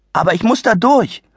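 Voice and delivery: put-on a polite kid insisting he must get through; plain boyish plea. Adi's request, then mocked.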